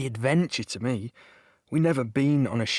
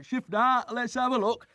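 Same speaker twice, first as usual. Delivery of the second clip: much higher, pushy call to see the photos; eager soldiers jostling; 11 semitones higher than usual. Retold or imitated. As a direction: shove forward, desperate to look. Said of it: "You can imagine we were sort of".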